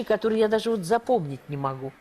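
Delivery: ordinary talk, nothing marked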